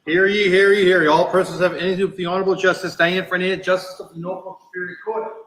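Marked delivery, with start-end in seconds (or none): none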